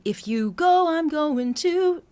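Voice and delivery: "If" sung separately and too clearly; clumsy articulated if. Shown as a demonstration. Coach showing the clumsy wrong way to sing "If". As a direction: overarticulate "If" clumsily. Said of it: "It's, it's not that".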